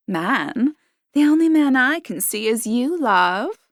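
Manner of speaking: lightly voiced coquettish woman, teasing; flirty barmaid. A narrator's reading. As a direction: tease him with a hint of flirt.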